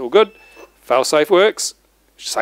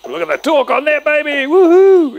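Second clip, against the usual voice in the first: excited whoop; 11 semitones higher, 8 dB louder than usual. Real, not acted. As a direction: cheer at the motor's power. Motor spins up on the bench.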